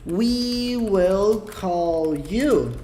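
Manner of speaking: very slow, drawn-out model sentence; teacher's slow-motion example; demonstrating slowly. Shown as a demonstration. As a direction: stretch each word out for learners. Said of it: "Eu posso falar assim".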